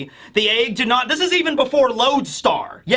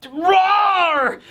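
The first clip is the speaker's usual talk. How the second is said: mock roar; 8 semitones higher than usual. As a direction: charge in roaring. [name] charging.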